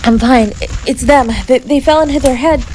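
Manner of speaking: high, loud, rushed and upset; frantic explaining. Acted. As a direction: brush off your own injury in a panic. Urging rescuers to help partner.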